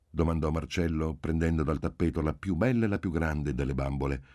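usual speaking voice

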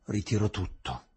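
low, prosaic statement; hard, matter-of-fact. A narrator's reading. Witness withdraws her whole accusation, hard and prosaic. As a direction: say it hard and without drama. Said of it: "In tono duro e prosaico disse".

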